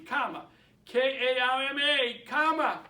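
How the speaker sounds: "Karma" said loudly, then spelled slowly letter by letter; spelled out clearly. Shown as a demonstration. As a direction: spell "K A R M A" loud and deliberate, then repeat "Karma". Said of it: "Let me spell that for my Boston accent".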